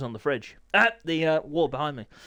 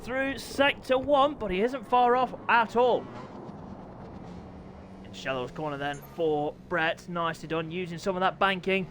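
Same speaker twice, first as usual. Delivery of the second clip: higher-pitched live race commentary; live play-by-play; 7 semitones higher than usual. Real, not acted. Commentator following Brett's qualifying lap, animated play-by-play. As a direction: call the race lap as it happens.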